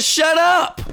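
high, slow, drawn-out "shut up"; shut up demand. Retold or imitated. Republicans telling Trump to shut up, high and drawn out. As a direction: stretch "up" high and slow, mock pleading. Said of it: "That's what the Republican Party is saying".

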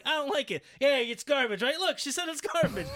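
high loud triumphant taunt; spring the trap gleefully; mock gotcha. CM Punk catching Paige in a trap, high and gloating. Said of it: "it's gonna be like".

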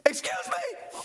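very high squeaky exclamation; hammed-up shocked falsetto; Nicodemus. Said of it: "I see exclaimed as he said".

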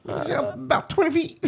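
high, soft, laughing put-on voice; Beetlejuice. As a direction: give a dopey quiz answer up high. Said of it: "Fucking Beetlejuice".

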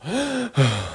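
high, exaggerated panting gasps; winded gasps. Girls just out of the cage, acted gasping. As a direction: pant as if just escaped at a run. Characters winded after running.

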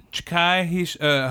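normal talking voice

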